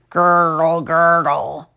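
gurgling tummy noise, voiced low; stomach gurgle imitation. A narrator's reading. Narrator voicing Arnold's growling stomach. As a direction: mimic a rumbling empty stomach. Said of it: "Arnold's stomach growled".

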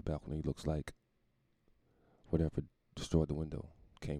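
lightly voiced brooding detective; Batman detective mode. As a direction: play Batman coolly reading a crime scene. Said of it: "flying somewhere like".

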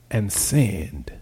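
breathy whispered aside, barely voiced; whispered confession. Confessing sin to the congregation.

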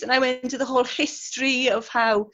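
normal talking voice

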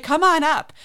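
loud, high call into the intercom; imitating an eager family shout. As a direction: holler an invitation downstairs. Acting out family calling down intercom.

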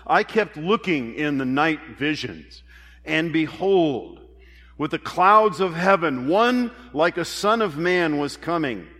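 rising, emphatic scripture reading. Acted. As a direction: proclaim the prophecy with mounting force. Reads scripture aloud with force.

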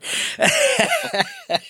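high, breathy nervous giggle; nervous laugh. Real, not acted. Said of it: "that's my nervous laugh right there".

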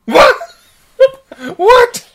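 shrill, loud disbelief; What?! disbelieving, cracking up; shocked. Real, not acted. Shocked reaction.

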